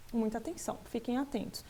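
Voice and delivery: usual speaking voice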